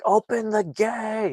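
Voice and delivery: high shouted call, drawn out; retold yell. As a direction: shout for the gate to open, high and slow. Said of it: "they're yelling".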